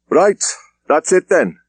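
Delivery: a superintendent calling it a day; firm. Acted. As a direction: snap the meeting shut with authority. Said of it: "he said firmly".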